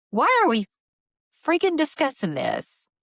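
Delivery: high, loud exasperated outburst; incredulous rant. Real, not acted. Exasperated rant about show.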